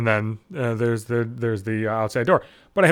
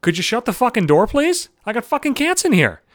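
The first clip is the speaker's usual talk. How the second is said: pitched-up irritated shout; exasperated high yell at visitors; 8 semitones higher than usual. Retold or imitated. Tenant yelling at careless visitors, pitched-up and exasperated. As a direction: yell at them to shut the door. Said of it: "I was like".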